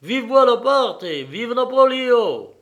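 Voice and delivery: high, loud cry of acclaim; shouted cheer. A narrator's reading. Alatte hails the emperor from the crowd, shouted cheer.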